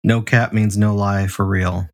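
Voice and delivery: normal talking voice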